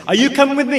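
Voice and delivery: high, loud retold question; retold rallying call. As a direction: call it out as if summoning followers. Retold call to each person.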